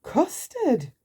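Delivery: high custard; joyful mistake; Mister Nonsense sees yellow snow; delighted cry. Acted. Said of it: "he said looking out".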